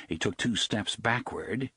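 ordinary delivery, nothing marked

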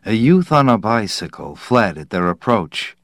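usual speaking voice

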